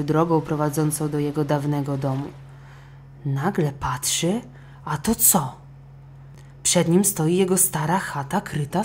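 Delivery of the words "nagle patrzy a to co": plain reading voice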